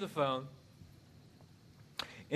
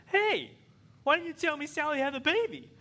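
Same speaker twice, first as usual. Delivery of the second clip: much higher, louder wife voice; wife demanding; 13 semitones higher, 4 dB louder than usual. Retold or imitated. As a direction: confront him at the dinner table. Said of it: "my wife says to me".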